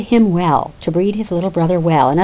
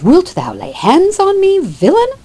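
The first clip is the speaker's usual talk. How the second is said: much higher, louder mock outrage; an indignant older brother crying out in a staged fight; outraged cry; 11 semitones higher, 4 dB louder than usual. Oliver outraged during fist fight.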